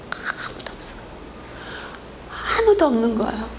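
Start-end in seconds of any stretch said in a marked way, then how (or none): 0.1-1.0 breathy whisper of the empty funeral hall; hushed whisper. Real, not acted. Pastor to congregation, recalling the empty hall, in a whisper. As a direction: whisper it as if afraid to break the silence.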